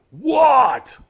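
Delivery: high, loud goblin shouts; alarmed shout. Acted. Goblins hearing of the fire, shouting in alarm.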